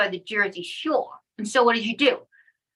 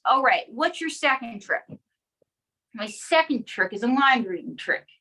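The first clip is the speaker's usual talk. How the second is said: ordinary delivery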